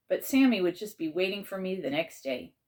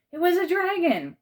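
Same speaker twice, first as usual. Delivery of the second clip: high, loud burst of amazement; astonished; 13 semitones higher, 6 dB louder than usual. Acted. Boy sees the dragon, loud and amazed. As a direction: blurt it out in shock. Shocked discovery.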